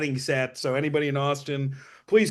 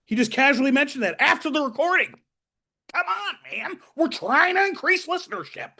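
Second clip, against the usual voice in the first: mock exasperated; 12 semitones higher than usual.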